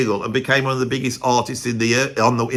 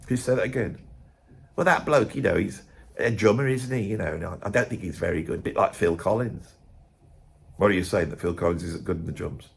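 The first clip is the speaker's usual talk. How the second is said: muttered, quieter back-and-forth; mock hushed exchange; 6 semitones lower, 7 dB quieter than usual. Colleagues muttering at work, hushed and gossipy. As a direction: hush it like a gossipy office chat. Mock quarrel over [name].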